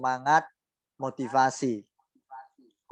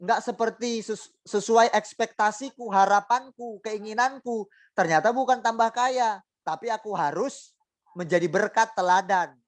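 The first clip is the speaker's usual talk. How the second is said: high whiny sulk, as a grumbling believer; sulking complaint; 7 semitones higher than usual. Acted. A congregant sulking to God about her hardship, whiny. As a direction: pout and whine at God. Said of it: "Coba mereka ngambek".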